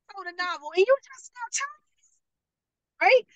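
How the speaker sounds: very high, incredulous squeak; mock disbelief. Class leader reacting to the late novel reveal, mock disbelief. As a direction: repeat it as if you can't believe your ears.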